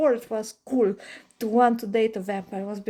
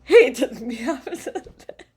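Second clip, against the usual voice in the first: high, giggly talk; laughing while talking; 7 semitones higher, 6 dB louder than usual. Real, not acted. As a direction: laugh through the words. Laughing through the confession.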